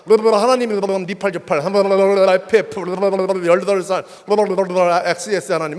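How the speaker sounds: loud, ranting curses thrown upward; retold cursing at God. Young man cursing God in church, loud ranting. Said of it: "이렇게 막 했었어요".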